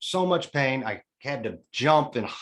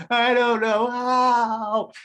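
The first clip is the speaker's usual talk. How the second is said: much higher, slow, louder wailing wife voice; imitating tearful wife; 11 semitones higher, 5 dB louder, much slower than usual. Husband mimics his crying wife doubting him on the phone, high and wailing. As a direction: whine it out like his teary wife.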